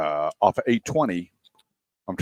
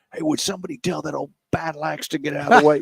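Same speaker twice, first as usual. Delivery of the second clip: higher, impatient put-on voice; radio chatter complaint; 7 semitones higher than usual. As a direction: grumble over the radio in a different voice. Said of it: "where they're communicating or going".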